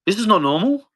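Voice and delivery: high, bewildered voice of a man losing it; voicing a film character, lost and confused; confused lonely man. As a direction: sound lost and baffled, like nobody has spoken to you for years. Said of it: "He's like".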